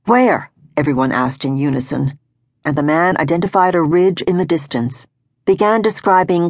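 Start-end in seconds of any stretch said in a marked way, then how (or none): 0.1-0.5 high, eager and a touch louder; group of watchers asking eagerly where the wolves are; eager question. Acted. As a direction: jump in eagerly, all together.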